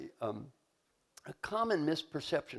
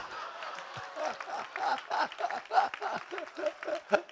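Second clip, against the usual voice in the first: squeaky little laugh; 14 semitones higher than usual. Retold or imitated. Inner voice laughs after teasing.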